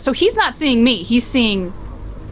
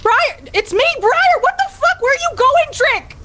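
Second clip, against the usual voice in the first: much higher, desperate calling out; panicked calling; 13 semitones higher than usual. Acted. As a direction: call after him in fear, much higher. Frightened friend calling after him.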